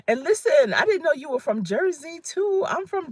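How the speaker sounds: light up at the shared home state; excited surprise. Real, not acted. Finds viewer shares her hometown.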